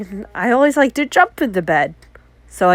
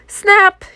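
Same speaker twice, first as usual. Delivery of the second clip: snap sound; 10 semitones higher, 5 dB louder than usual. Retold or imitated. Retold tablet pen breaking.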